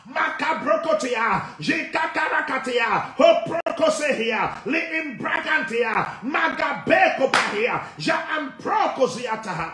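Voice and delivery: full-force deliverance prayer, chanting and speaking in tongues; fiery preaching. Acted. Pastor to congregation, commanding deliverance in full preaching voice. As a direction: preach it out, rebuking with fire.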